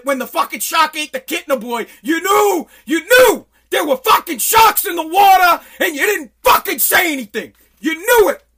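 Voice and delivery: loud, high accusing yell; furious shout. Real, not acted. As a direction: hammer "You knew" like an accusation. Yelling at president about sharks.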